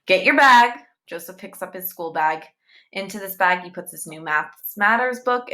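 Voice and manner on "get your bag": loud, high curt command; stern order. A narrator's reading. Angry teacher ordering the boy out, loud. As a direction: bark it at the pupil.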